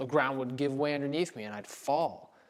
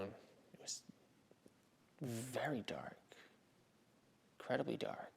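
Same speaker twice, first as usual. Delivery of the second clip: near-whispered, much softer narration; hushed; 11 dB quieter than usual. Acted. Centurion recalls the darkness that afternoon, hushed.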